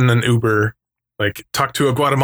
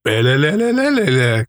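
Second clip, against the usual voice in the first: slow warbling robot babble; robot noise; 2 semitones higher, slower than usual. Retold or imitated. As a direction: burble it like a broken robot. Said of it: "the robot's all".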